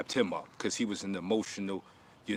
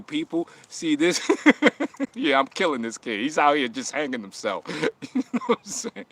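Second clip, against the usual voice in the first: higher, louder gloating through laughs; gloating laughter; 5 semitones higher, 5 dB louder than usual. Real, not acted. Gloating to his audience, laughing. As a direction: crow to the crowd while laughing.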